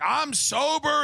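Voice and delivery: high, slow proud sobriety claim; drawn-out, beaming I'm sober; hammed-up recovering drinker; mock sober guy. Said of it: "he's like".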